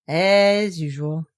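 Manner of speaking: slow, mournful Eeyore drawl; melancholy. A narrator's reading. Eeyore left without food, melancholy. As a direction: sigh it out, gloomy donkey. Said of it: "in his melancholy way".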